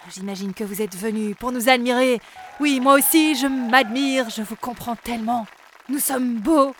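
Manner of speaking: loud, preening star voice; silly clown. Acted. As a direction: boast like a vain idol. Vain elf singer boasting.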